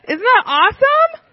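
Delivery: bright, high cheer; excited. Real, not acted. Marvelling to kids.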